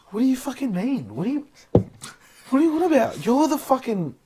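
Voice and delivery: indignant. Retold or imitated.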